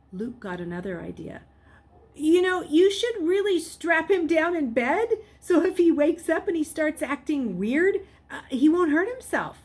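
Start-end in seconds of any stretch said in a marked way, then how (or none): 2.1-9.6 high, loud, pushy suggestion; boy urging the nurse earnestly; eager urging. Acted. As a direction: press the idea a bit too hard. Luke urging nurse to strap Jason.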